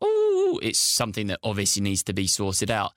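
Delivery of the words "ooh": high, sustained "oooh"; drawn-out ooh. Acted. Presenter acting out a reaction to the tanker, high drawn-out ooh. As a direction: let out a long, high "ooh". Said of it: "sit there like".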